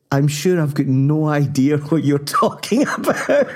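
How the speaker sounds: lightly imitated fruity wife; mock-proper Italian wife; wife's reply. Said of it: "And she says".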